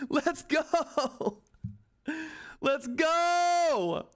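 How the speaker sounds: high, thrilled near-cry cheer; ecstatic cheer. Real, not acted. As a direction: cheer the spike like a goal. Price spikes, cheering live.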